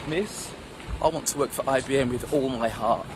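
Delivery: low, subdued, heartfelt answer; lightly acted crestfallen schoolboy, voice dropped; downcast pupil. Sulking pupil telling Miss his dream, low and heartfelt.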